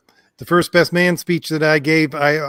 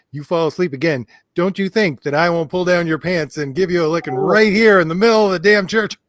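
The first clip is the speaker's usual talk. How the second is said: mother's threat; 3 semitones higher, 3 dB louder than usual. Retold or imitated. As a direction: start level, then build to a fierce warning. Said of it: "put the fear of God in me".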